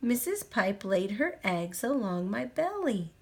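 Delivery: ordinary delivery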